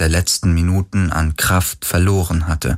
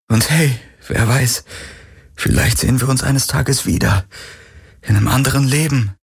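higher, brave tearful voice; tearful hope; 6 semitones higher than usual. Acted. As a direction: smile through the tears. Crying Galen forces hope.

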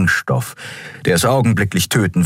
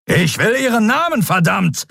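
roaring demand for a name; thundering. A narrator's reading. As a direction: thunder it out much higher. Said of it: "donnerte Thelios".

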